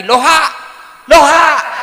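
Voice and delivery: high, loud loha, laughing at the end; loud mock loha of a confused old man; loud backwards hello. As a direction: yell the reversed word. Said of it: "Dia bilang lagi".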